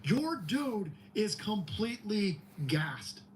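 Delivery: slow, emphatic taunt. Real, not acted. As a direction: spell it out slowly.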